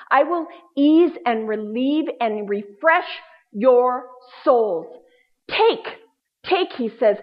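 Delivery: slow, earnest reading of Jesus' words; scripture voice. Acted. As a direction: lean into each promise, unhurried.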